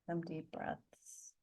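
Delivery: soft, unhurried meditation voice with long pauses; guided meditation. Acted. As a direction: gently guide the group to settle, leaving space between phrases.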